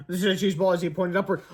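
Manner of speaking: usual speaking voice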